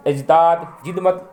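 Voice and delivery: ordinary delivery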